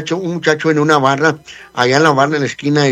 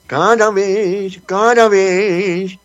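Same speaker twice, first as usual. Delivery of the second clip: drawn-out mock drama; 5 semitones higher, much slower than usual. Dramatic slowed repeat on air.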